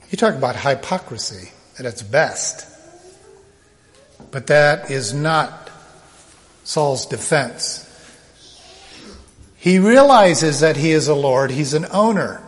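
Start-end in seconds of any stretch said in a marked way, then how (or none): none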